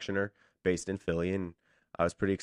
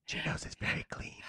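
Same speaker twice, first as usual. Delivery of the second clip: voiceless, hushed disclaimer; mock-secret whisper; 8 dB quieter than usual. Guest covering for the sandwich shop, in a playful whisper.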